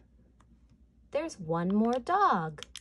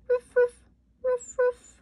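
high yappy dog barks; 9 dB louder than usual. Acted. Dog noises in counting song.

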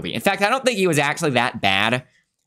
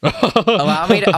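laughing mock boast; 6 semitones higher, 5 dB louder, slower than usual.

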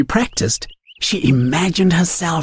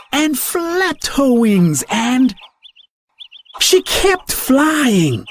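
rising higher and slower each time; building excitement; 7 semitones higher, slower than usual. A narrator's reading. Narrator builds suspense as Daisy climbs, excited. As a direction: lift the voice with each flap.

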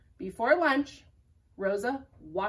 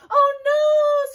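higher, louder squeaky scream; germ scream; 13 dB louder than usual. Acted. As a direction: shriek in cartoon panic. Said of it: "screamed the next germ".

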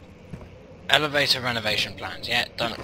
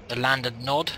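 ordinary delivery

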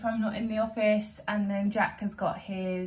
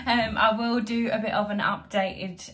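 normal talking voice

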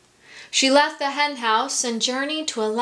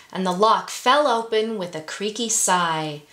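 usual speaking voice

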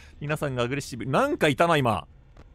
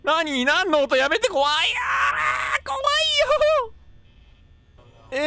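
high panicked pleading breaking into a scream; frightened; 5 dB louder than usual. Real, not acted. Gamer hearing a noise and begging it to stop, panicked cry. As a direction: beg it to stop, pitched up.

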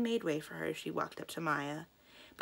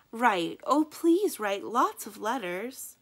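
pleading farewell; 5 semitones higher, 8 dB louder, slower than usual. A narrator's reading. Schoolgirl farewell.

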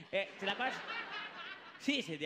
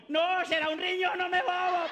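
loud mother in labor; 8 semitones higher, 7 dB louder than usual. Retold or imitated.

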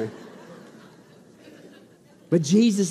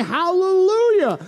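high, long-held hallelujah; thunderous shouted praise; 11 semitones higher, 6 dB louder, much slower than usual. Acted. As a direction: shout it slowly for a great multitude. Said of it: "like the loud peals of thunder shouting".

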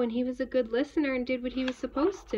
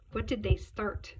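usual speaking voice

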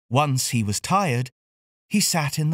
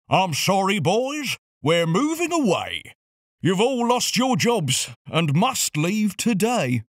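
lightly voiced boss, a little higher, breaking bad news; a hint of the sorry garage boss; 5 semitones higher than usual. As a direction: boss sadly telling the boys they've lost their jobs. Boss Mick announces closure.

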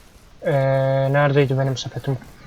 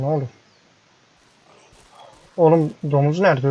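ordinary talk, nothing marked

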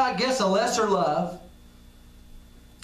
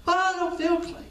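doubting believer; 9 semitones higher than usual. Pretend.

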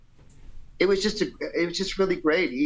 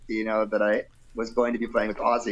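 ordinary talk, nothing marked

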